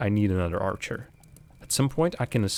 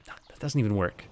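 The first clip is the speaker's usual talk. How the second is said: usual speaking voice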